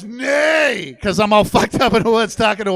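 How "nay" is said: loud, high drawn-out whinny; horse neigh. Retold or imitated. Horse answering a confused rider, imitated neigh. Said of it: "And the horse goes".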